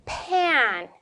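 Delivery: usual speaking voice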